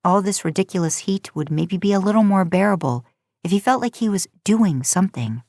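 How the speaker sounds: ordinary delivery, nothing marked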